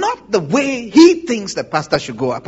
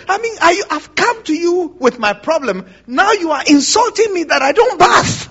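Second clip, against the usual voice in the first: mock offended complaint; 6 semitones higher, 4 dB louder than usual. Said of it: "very offended and upset".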